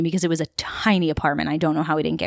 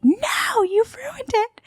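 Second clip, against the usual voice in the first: very high, squeaky mock wail; hammed-up, high-pitched tantrum; dramatic friend's wail; much slower than usual. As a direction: squeal it like a thwarted drama queen. Acting out friend's complaint after failed escape.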